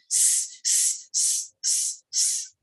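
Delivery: an unvoiced hissing "s" for the snake. A narrator's reading. Storyteller voicing the snake's hiss for the class. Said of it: "the snake was making the sound".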